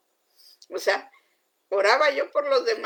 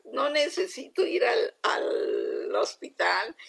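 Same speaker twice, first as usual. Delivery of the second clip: crying prayer; 3 semitones higher, slower than usual. Retold or imitated. Pleading with God not to need surgery, crying. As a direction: voice breaking into tears on hospital. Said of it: "yo lloraba".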